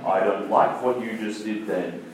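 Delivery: low, soft voice from above; imagined audible voice. Acted. Preacher imitating God speaking to a listener online, low hushed voice. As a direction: drop into a deep, quiet pronouncement. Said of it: "voice that you hear going".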